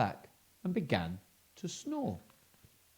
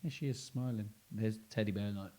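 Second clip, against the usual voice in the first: usual speaking voice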